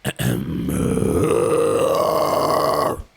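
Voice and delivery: projected low rasp; deep rasping growl. Shown as a demonstration. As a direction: push it from the diaphragm. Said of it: "use your diaphragm".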